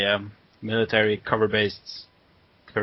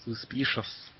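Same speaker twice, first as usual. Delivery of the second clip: suspicious whisper; 10 dB quieter, slower than usual. Pretend. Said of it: "jokey suspicious whisper".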